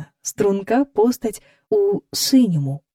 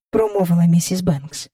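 ordinary delivery

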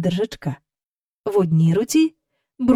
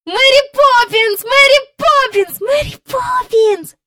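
високо й гучно, радісно; гукай її ім'я від щастя; радісний крик; 14 semitones higher, 7 dB louder than usual. A narrator's reading. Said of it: "кричали обоє". (діти радісно кричать ім'я)

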